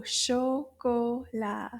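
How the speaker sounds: "Chocolat" very slow, in three separate beats; syllable by syllable. Shown as a demonstration. Teacher confirms three syllables, "Chocolat" slowly split into beats. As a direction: draw out each syllable of "Chocolat". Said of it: "Trois syllabes".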